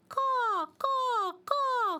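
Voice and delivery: high loud cawing; crow call. Acted. Crow caws as the dreidel passes. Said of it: "called the crow".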